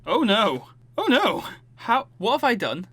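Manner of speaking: real dismay at the wrong build. Real, not acted. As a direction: realise you've wrecked it.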